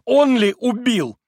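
high, slow, hysterical outcry; hysterical cry. A narrator's reading. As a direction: cry it out, losing control. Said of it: "воскликнула она вдруг истерически". (истерический возглас)

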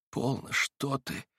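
тихо и медленно, растерянно; пролепетать потерянно; растерянный лепет. A narrator's reading. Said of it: "пролепетал было он, как потерянный". (Алёша тихо и растерянно лепечет)

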